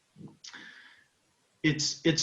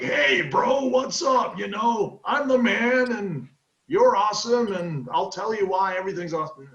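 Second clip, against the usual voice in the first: mock over-hyped bro, pitched up; fake charisma; 7 semitones higher, 4 dB louder than usual. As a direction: oversell it like a phony smooth talker. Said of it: "not fake charisma, which people can".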